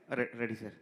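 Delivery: ordinary delivery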